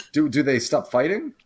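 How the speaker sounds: usual speaking voice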